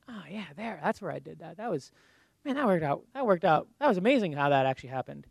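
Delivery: hushed private reflection, half to self; quiet inner voice. Pretend. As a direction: mutter it to yourself, recalling.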